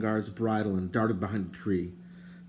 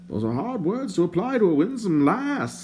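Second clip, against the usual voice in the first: higher, prim and indignant stranger; lightly voiced fussy stranger; 10 semitones higher than usual. A narrator's reading. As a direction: play the fussy bard, put out. The bard's voice.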